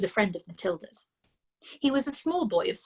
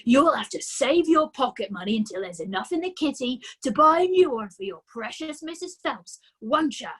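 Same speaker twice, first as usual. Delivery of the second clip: a louder, bullying father voice; sneering father; 4 semitones higher, 6 dB louder than usual. A narrator's reading. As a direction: taunt her like a smug dad. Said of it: "the father said".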